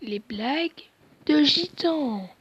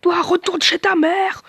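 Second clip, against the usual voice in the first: taunting; 6 semitones higher, 6 dB louder than usual. Acted. Taunting enemies in the game.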